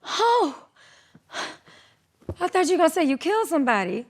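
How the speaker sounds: high, fast mother's relieved rush; frantic mom. Retold or imitated. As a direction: mimic mom's frantic relief. Mom relieved after the panic.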